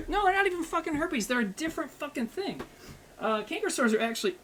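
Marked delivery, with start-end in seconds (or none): 0.1-2.6 high-pitched mock exasperation; exasperated correction. Streamer correcting people who joke about his canker sores, exasperated. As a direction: protest the stale joke in a higher, exasperated voice.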